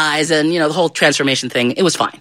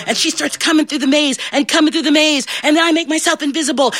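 ordinary delivery

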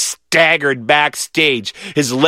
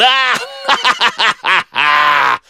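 long staccato evil laugh; villainous cackle; 6 semitones higher than usual. A narrator's reading. Two boys playing villains on stage, gleeful cackle. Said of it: "We cackled".

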